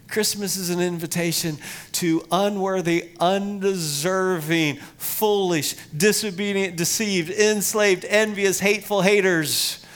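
each word hit harder, building up; rising sermon list. Acted.

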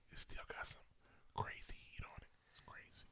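breathy hushed whisper. Real, not acted. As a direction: whisper it like a secret. Whispering about the album.

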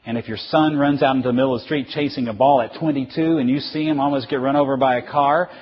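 ordinary delivery

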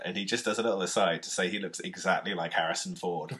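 normal talking voice

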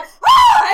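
high, loud shriek of alarm; startled scream. Retold or imitated. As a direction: let out a piercing yelp. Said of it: "I, like, screamed at her".

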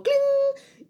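high, loud ringing ding; imitated "cling" noise. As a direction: mimic a little chime going off. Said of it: "me acuerdo así como".

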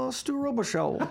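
a hint of a Cajun character voice; lightly voiced Louisiana Cajun; Cajun accent. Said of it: "don't you have a character?".

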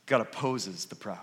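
God opposes the proud said softly and low; soft, sobering repeat of the verse; quiet emphasis. Shown as a demonstration. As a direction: drop the voice to let it sink in. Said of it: "Now, what does he say?".

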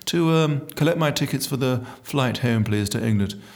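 put-on posh royal English accent, hesitant; mock-regal posh English; Prince Charles. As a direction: put on plummy royal English to pass as sober. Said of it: "I was like Prince Charles".

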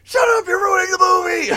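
high, loud shouted shushing; annoyed shout. Retold or imitated. Annoyed viewers yell at her to stop ruining it, shouting. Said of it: "everybody's like".

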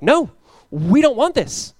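high, loud, drawn-out objection; acted protest. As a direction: cry out like a crowd refusing. People protest the harsh answer.

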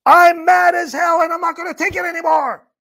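high, furious movie-character shout; yelling at full volume. Acted. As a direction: yell it out to the whole street. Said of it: "yell at the top of your voice".

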